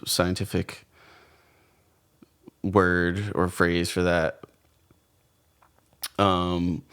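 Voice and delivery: groggy hungover voice. Real, not acted. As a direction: hungover and sluggish. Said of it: "I'm kinda hungover right now".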